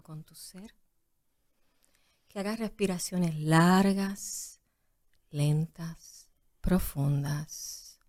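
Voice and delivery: calm breathing instructor, hushed and unhurried; yoga teacher voice. Shown as a demonstration. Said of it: "en tu voz de maestra de yoga".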